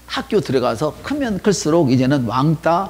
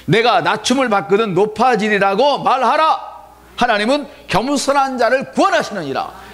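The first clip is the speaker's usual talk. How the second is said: higher, proclaiming read of scripture; emphatic reading; 9 semitones higher, 3 dB louder than usual. Shown as a demonstration. Pastor rereads the verse to the church, proclaiming. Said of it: "제가 다시 읽어드릴게요".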